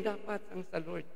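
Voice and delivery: ordinary talk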